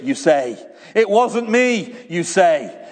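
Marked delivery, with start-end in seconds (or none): 0.9-1.9 high, slow pleading denial; a child insisting it wasn't them to the headmaster; child's denial. Retold or imitated. Child insists to the headmaster, high protesting denial.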